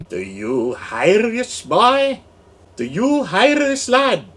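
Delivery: loud, high mock Irish brogue; mock Irish accent. Host hamming an Irish accent at his viewer, mock brogue. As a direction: ham up an Irish accent calling to the lad. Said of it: "I'm doing a Slovenian or an Irish".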